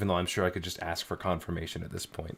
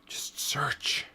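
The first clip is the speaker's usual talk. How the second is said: whisper; 6 semitones higher, 4 dB quieter than usual. Real, not acted. Muttering to himself while searching.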